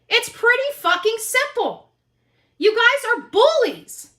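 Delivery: normal talking voice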